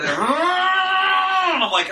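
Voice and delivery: a long, held, very high motor whine; imitated engine roar. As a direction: mimic a revving motorbike engine. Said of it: "It also sounds like a car".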